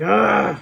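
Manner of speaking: loud, drawn-out ugh; dismayed groan. Retold or imitated. Woman realizing she answered the wrong guy, loud dismayed groan.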